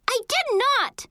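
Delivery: high-pitched yelled denial; indignant yell. A narrator's reading. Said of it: "I yelled".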